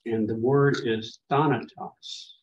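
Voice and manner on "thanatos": THA in thanatos stressed, the rest lighter; stress on first syllable. Shown as a demonstration. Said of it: "That's the first of the three syllables".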